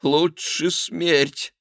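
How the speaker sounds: high, slow, strained; defiant refusal. A narrator's reading. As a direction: declare it in a drawn-out high voice. King refuses to abdicate.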